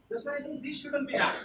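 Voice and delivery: normal talking voice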